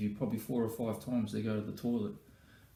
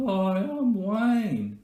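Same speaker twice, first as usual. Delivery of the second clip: loud, drawn-out high-pitched goofy voice; hammed-up high, slow silly introduction; mock a mate's odd voice, high and stretched; Wayne; 10 semitones higher, 6 dB louder, much slower than usual. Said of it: "What's wrong with your voice?".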